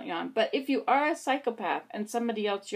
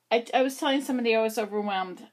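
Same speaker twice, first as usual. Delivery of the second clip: ordinary talk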